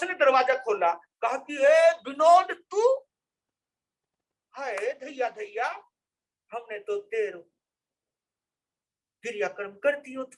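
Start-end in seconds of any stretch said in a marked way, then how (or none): 1.6-2.9 high, loud and slowly drawn out, as if seeing a ghost; stunned mother-in-law cries out at the door; startled cry. Acted. Mother-in-law opens door, sees him. 4.5-10.2 mother-in-law murmurs that they already held his last rites; stunned old mother. Acted. Mother-in-law stunned, thought him dead.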